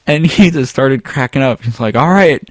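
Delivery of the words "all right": put-on high, loud hillbilly accent with laughter. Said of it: "He read a hillbilly accent".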